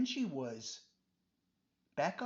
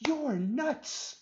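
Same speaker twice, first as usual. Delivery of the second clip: high, loud disbelieving cry; shocked outburst; 9 semitones higher, 5 dB louder than usual. Acted. Adam gasping at his friend's crazy plan, shocked. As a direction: blurt it out in shock.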